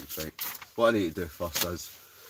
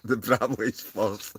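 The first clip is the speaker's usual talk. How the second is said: usual speaking voice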